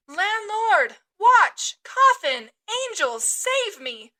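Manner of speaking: high, loud calls for help that fade; frantic shouting. Acted. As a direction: yell every name you can think of. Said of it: "shouted I".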